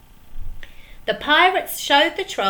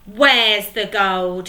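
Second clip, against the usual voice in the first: slow, louder demanding shout; angry shout; 7 dB louder, slower than usual. Acted. Pirate Handkerchief shouting at the troll, angry. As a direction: demand it, each word heavy. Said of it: "shouted Handkerchief".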